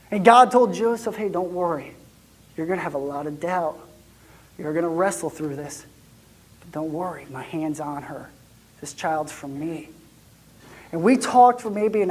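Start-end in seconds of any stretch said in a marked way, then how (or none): 1.2-9.9 gentle reassurance. Retold or imitated. God reassuring Joseph.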